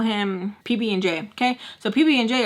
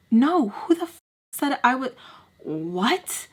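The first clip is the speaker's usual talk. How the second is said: outraged denial; 4 semitones higher, slower than usual. Retold or imitated.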